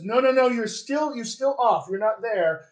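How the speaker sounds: mock speech teacher losing patience; exasperated teacher. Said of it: "the speech teacher says".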